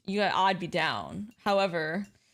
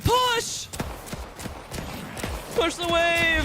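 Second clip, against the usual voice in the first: shouted command; 8 semitones higher, much slower than usual. Real, not acted. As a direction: bark the order at the team. Calling orders to teammates.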